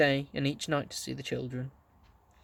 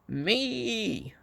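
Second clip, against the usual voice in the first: a higher screeching owl cry; 8 semitones higher than usual. A narrator's reading. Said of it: "screeched the owl again".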